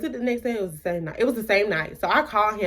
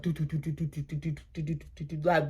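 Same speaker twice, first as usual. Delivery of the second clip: heartbeat noise; 3 semitones lower, 8 dB quieter than usual. Shown as a demonstration. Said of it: "beating, beating, beating".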